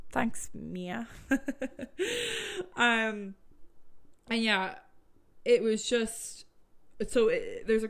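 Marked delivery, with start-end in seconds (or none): 1.1-2.7 a breathy high laugh; high giggle. Real, not acted. Laughing at her sister calling her [name]. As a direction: laugh at her own joke.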